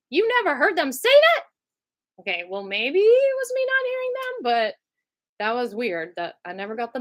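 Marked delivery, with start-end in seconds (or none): 0.1-1.4 mock incredulous staff. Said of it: "They're like".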